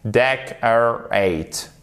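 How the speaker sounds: "Decorate" broken slowly into DEC-er-ate; slow syllable-by-syllable stress. Shown as a demonstration. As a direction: spell out each syllable of "Decorate", DEC strongest. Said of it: "accent tonique sur la première syllabe". (« Decorate » très lent, syllabe par syllabe, accent sur DEC)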